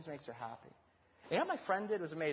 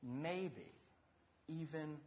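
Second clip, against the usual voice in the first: gentle hint; 10 dB quieter, slower than usual. Retold or imitated. Friend's gentle suggestion.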